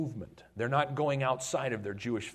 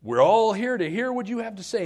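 eager Cornelius; 9 semitones higher, 8 dB louder than usual. Acted. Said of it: "He goes".

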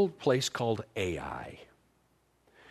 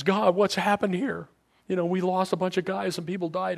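pleading Joshua; 8 semitones higher than usual. Retold or imitated. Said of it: "And, and he goes".

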